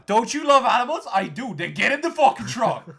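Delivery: very high, very loud hammed-up demand; bully them along at a comic yell; zealot shouting orders. Activist pressing a doubter into the truck, mock furious shouting.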